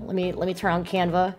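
normal talking voice

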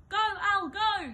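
high, loud cheering yell. A narrator's reading. Father cheering his son in the race, loud high yell. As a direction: cheer your son on at full volume. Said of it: "yelled his father".

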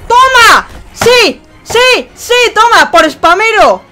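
screaming with joy, very high; victory scream. Real, not acted. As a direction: scream in triumph. Wins against spammer.

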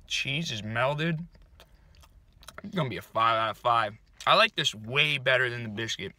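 speaking between bites; talking while chewing. Real, not acted. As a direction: mouth partly full of food. Seen 0: Cheeks bulge, closed-lip chewing between words; eating breakfast sandwich in car.

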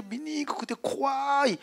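ordinary delivery

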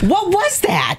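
high, scared shouting; startled, frightened cry; screaming in fear. Real, not acted. Monster jump scare.

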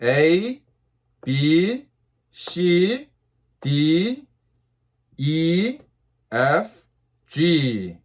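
letters read slowly, one by one; slow alphabet drill for repeating. Shown as a demonstration. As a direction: draw out each letter and pause after it. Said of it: "다시 한번요".

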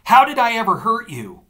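yelling in fury; furious, pitch soaring; raving. A narrator's reading. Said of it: "I raved".

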